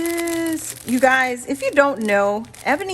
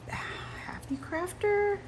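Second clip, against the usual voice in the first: whispered name; 6 semitones higher, 9 dB quieter than usual. Real, not acted. Announcing sender's nickname.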